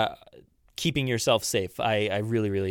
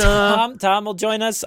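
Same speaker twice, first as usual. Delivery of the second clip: higher, raised voice aimed through the floor; calling upstairs; 8 semitones higher, 6 dB louder, slower than usual. Pretend. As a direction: call up to someone upstairs. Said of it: "I'm actually looking up when I say this".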